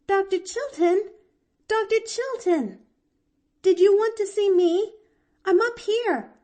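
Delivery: put-on very high, loud girlish hail; delighted calling out. A narrator's reading. Girl calling from the window to the doctor, delighted. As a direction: call down to him with delight. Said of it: "Delightedly, she leaned forward".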